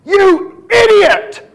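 high, loud, drawn-out yell; retold angry shout. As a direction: shout it like a furious tech scolding someone. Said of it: "All I heard was".

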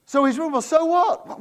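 high, loud sarcastic so what; mock shrug of a bored skeptic. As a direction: lampoon the shrug. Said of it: "but we think".

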